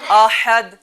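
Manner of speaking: احد loud, with a hard throat h; forceful ha. Shown as a demonstration. Teacher urges children to read harder, forceful احد. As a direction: drive the ح of احد out strongly. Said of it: "اور زور سے پڑھیں".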